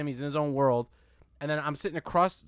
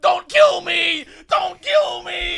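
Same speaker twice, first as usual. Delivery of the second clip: imitated drawn-out high shriek; terrified plea; 13 dB louder, much slower than usual. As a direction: scream slowly in mock terror for your life. Acted kid begging for his life.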